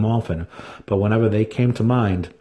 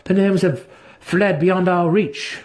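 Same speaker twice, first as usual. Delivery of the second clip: put-on higher, slow, evasive young voice; young drow Driz; 10 semitones higher, slower than usual. To his brother, deflecting: higher, slow evasive reply.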